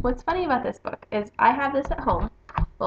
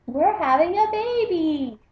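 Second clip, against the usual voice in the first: lily's voice; 6 semitones higher than usual. Acted. Lily voiced in picture book.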